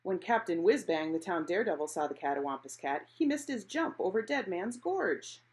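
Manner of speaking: plain reading voice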